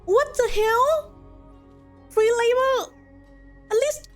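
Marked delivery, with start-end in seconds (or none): none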